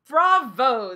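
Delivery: loud, delighted praise; beaming bravo. A narrator's reading. Old doctor praising the boy's horses, loud and delighted. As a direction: cheer the boy's answer. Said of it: "the doctor beamed".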